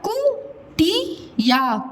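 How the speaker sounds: कुटिया stretched slowly; कुटिया drawn out, every sound long. Shown as a demonstration. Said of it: "अब देखो क्या लिखा हुआ है".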